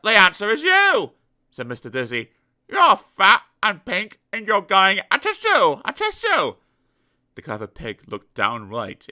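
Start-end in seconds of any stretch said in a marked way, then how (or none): 0.0-6.6 higher, loud gloating answer; triumphant Mister Dizzy. Acted. Mister Dizzy answering his own riddle, gleeful. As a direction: rub in the joke.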